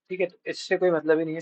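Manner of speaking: ordinary talk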